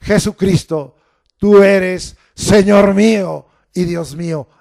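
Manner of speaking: loud exclamation of faith; preacher leading the confession aloud. Shown as a demonstration. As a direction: lift your voice and declare it. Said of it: "yo puedo levantar mi voz y en signo de admiración decirle".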